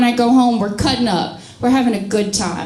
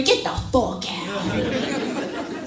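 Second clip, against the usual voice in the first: feminist wolf, hissed out; 2 semitones lower, 9 dB quieter, much slower than usual. Retold or imitated. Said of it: "feminist wolf".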